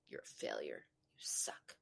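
harsh whispered put-down; cruel whisper. Retold or imitated. Adult whispering insults to their younger self, cruel and hushed. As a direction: hiss it at the little kid. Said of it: "Would you say".

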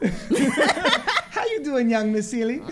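high, slow, laughing sing-song; playful drawn-out greeting. Pretend. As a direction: stretch it out like a game show host. Host greets guest as her character.

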